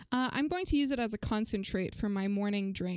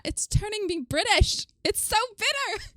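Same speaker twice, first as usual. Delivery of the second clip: high, loud cry of disgust; bitter-tea outcry; 10 semitones higher, 5 dB louder than usual. Real, not acted. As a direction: recoil from a horribly bitter taste, pitched way up.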